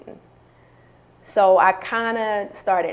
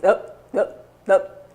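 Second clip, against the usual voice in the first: voice skipping; 3 dB louder, slower than usual. Shown as a demonstration. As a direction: voice cutting in and out on each up. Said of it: "I was talking maybe like this".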